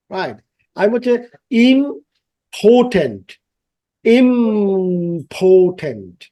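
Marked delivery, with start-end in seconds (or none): 1.5-3.4 "important" slowly, in separated syllables; sounded-out word. Shown as a demonstration. Teacher models a word for students, "important" slowly sounded out. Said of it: "আমি উচ্চারণ করছি". 4.0-6.2 "important" very slowly, holding the nasal "ইম"; drawn-out pronunciation demo. Shown as a demonstration. Teacher shows each sound to students, "important" very drawn out. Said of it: "আমি উচ্চারণ করছি".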